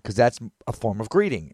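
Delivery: usual speaking voice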